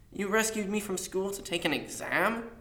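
high, incredulous question. A narrator's reading. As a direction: question it in disbelief, ending on a rise. Boy astonished at being given exam.

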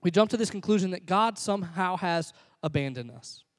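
ordinary talk, nothing marked

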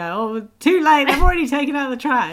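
loud mock-innocent Phoebe voice; Phoebe's cover-up.

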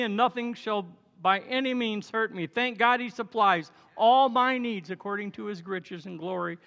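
usual speaking voice

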